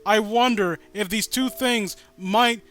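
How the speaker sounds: loud sarcastic mock thinking, slowing on the punchline; a hint of a mocking dim Tom. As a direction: spell out the obvious in a mocking voice. Mocking Tom's obvious inner thought.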